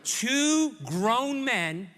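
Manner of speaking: ordinary delivery, nothing marked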